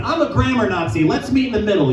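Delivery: normal talking voice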